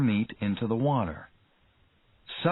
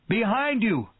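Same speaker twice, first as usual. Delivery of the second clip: warning shout; 11 semitones higher than usual. A narrator's reading. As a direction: shout it at Quint's back. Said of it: "cried Hooper".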